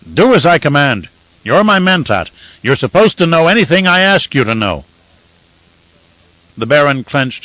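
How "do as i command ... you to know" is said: louder, higher commanding rant; domineering order. A narrator's reading. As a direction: cut him down, no arguments. Baron overriding mentat's protest.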